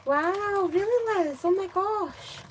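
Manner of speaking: surprised. Real, not acted. Reacting to a viewer's comment.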